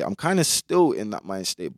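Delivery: ordinary talk, nothing marked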